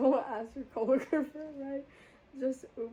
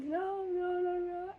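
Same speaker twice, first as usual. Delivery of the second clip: panicked pleading; 5 semitones higher, slower than usual. Retold or imitated. Pleading with driver not to turn.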